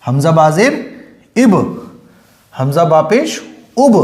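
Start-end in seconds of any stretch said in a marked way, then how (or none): none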